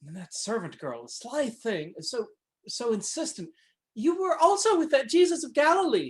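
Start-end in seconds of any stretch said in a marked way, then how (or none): 3.9-6.1 high, loud shrill accusation; shrill it out so everyone hears. Acted. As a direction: imitate a servant girl's shrill cry. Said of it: "she shrilled so that everyone heard".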